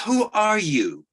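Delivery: secretary asking who is calling; suspicious secretary. Retold or imitated. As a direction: press the caller, drawn out. Secretary quoted on the phone.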